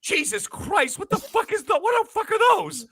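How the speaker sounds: high, rising shocked outburst; mock horror, pitch climbing up; shocked disbelief. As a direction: react like you just saw something horrifying. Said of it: "and I go".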